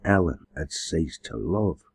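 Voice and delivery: usual speaking voice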